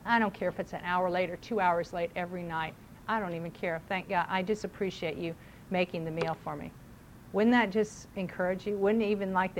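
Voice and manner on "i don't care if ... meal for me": soft, lower, tender thanks; a hint of a grateful husband. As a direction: reassure her gently. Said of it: "just go".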